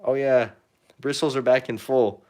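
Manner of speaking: usual speaking voice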